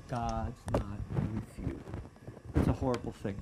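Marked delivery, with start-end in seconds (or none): 0.1-1.8 slow, drawn-out lie from the enemy; taunting enemy. Acted. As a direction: say it slowly and softly, like a discouraging lie. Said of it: "where he tries to say".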